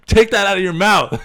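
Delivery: high, loud scolding with a laugh in it; exasperated yell. Retold or imitated. Counselor fed up after two weeks, scolding yell. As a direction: holler the order, half laughing. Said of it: "So by the end".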